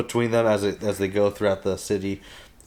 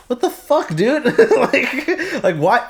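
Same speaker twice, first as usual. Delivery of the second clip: far higher, laughing mock outrage; exasperated Alicent; 14 semitones higher, 4 dB louder, slower than usual. Voicing Alicent fed up with Otto, laughing high mock outrage. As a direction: squeal it in disbelief through a laugh. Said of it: "she was like".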